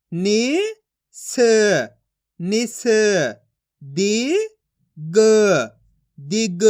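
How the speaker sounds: slow, syllable by syllable, each sound separated; teacher sounding out letters for young pupils; phonics drill. Shown as a demonstration. Teacher reads Hindi syllables to class, slow and deliberate.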